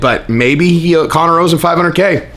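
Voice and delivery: normal talking voice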